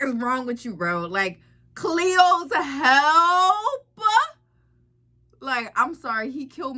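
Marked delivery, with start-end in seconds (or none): 1.7-3.8 drawn-out, louder disbelief; appalled, long-drawn question; incredulous. Real, not acted. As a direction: stretch it out slowly in sheer disbelief. Incredulous reaction to the name tag.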